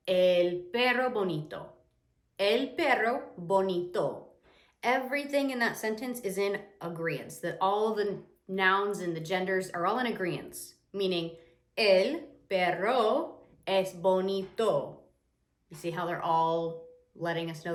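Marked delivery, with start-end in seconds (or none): 2.4-4.3 "El perro bonito" slowly, word by word; slow, carefully spaced Spanish example; slow teaching repeat. Shown as a demonstration. 11.8-15.0 very slow, louder Spanish example; slow teaching delivery with long gaps; word-by-word demonstration. Shown as a demonstration. Shows agreement word by word.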